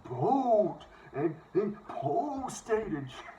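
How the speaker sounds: long, stretched Fargo O's, pitched high; hammed-up North Dakota accent. Shown as a demonstration. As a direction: exaggerate the Fargo accent, very slowly. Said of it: "I sound like I'm from fucking Fargo".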